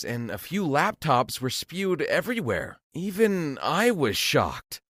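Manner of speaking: ordinary delivery, nothing marked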